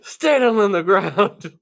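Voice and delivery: high, loud frantic yelling; John Mulaney doing George Bailey. Retold or imitated. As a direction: scream it like a comedian's bit. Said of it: "the John Mulaney bit where he does that".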